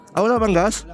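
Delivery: normal talking voice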